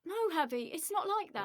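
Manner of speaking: high-pitched pleading denial; upset, high-voiced protest; distressed protest. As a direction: plead innocence to the boss. Izzy protests the drug accusation.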